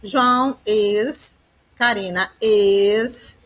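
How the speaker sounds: very slow, each subject and is drawn out; teacher pointing out the verb to be very slowly. Shown as a demonstration. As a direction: stretch out each is to show the verb. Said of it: "o verbo to be".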